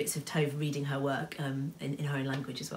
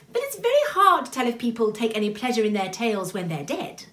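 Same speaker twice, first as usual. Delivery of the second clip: raised, higher character voice; reasoning Tooticky; 7 semitones higher, 6 dB louder than usual. A narrator's reading. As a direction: answer the upset one reasonably.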